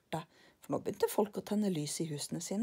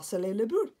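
plain reading voice

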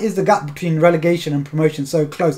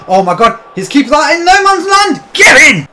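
real excited shout, much higher and louder; goal celebration; 8 semitones higher, 7 dB louder than usual. Real, not acted. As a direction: cheer the goal in disbelief. Scoring against open keeper.

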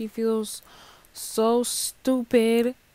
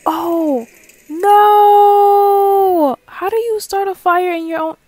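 loud high panicked cry of no; loud frightened wail; panicked scream; 9 semitones higher, 11 dB louder, slower than usual. Real, not acted. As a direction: shriek in real alarm as the fire starts. Fire starts while cooking.

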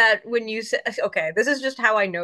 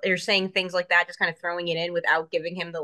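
ordinary talk

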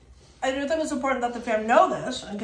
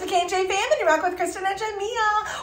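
excited vlog intro; 10 semitones higher, 3 dB louder than usual. Acted.

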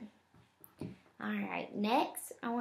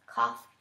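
sharp deliberate cough; demonstration cough; 5 dB louder than usual. Shown as a demonstration. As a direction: cough on cue. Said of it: "I want you to do this".

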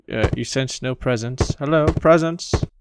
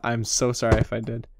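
ordinary delivery

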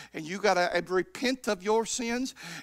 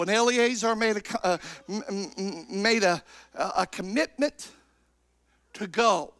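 usual speaking voice

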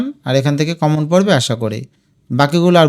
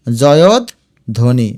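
জয়ধ্বনি very slowly as joyod, dhoni; lower, with a long pause inside জয়ধ্বনি; broken into parts; 5 semitones lower, much slower than usual. Shown as a demonstration. Teacher shows students the parts, জয়ধ্বনি slowly split. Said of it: "এই দেখো".

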